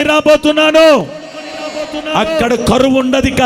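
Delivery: rising revival cry that lands each line hard; preaching at full force. Acted. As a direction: drive the promise home to the congregation. Preaching climax.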